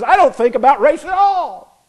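high, loud, amused divine pronouncement; voice of God. Retold or imitated. God laughing down at left and right, high loud mock decree. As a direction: play God mocking both squabbling sides, high and loud. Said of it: "God sits up there and laughs at them and says".

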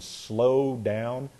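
stretched, slow "slow down". Shown as a demonstration. Preacher explains how English slows you, words slowed right down. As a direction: drag the words out to act out slowing.

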